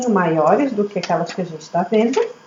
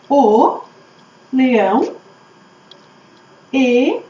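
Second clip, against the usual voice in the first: one word at a time, drawn out; dictating while writing; much slower than usual. Shown as a demonstration. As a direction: pause after each word as you write it. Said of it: "O que será que eu escrevo aqui".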